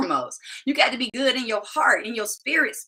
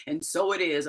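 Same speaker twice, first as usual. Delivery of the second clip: low-pitched, solemn spiritual teacher impression; Iyanla Vanzant; 6 semitones lower, 3 dB quieter than usual. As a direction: close an affirmation low and sure. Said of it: "in my Iyanla Vanzant voice".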